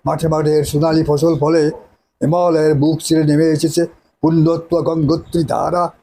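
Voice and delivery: grand theatrical declamation as a nawab; hammy stage actor. Acted. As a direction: declaim it like a tragic stage hero. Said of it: "নায়কের dialogue আলাদা".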